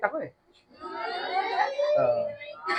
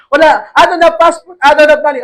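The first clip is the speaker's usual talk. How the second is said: louder, higher protest of having nothing; flustered protest; 7 semitones higher, 7 dB louder than usual. Retold or imitated. Protesting to the Korean caller.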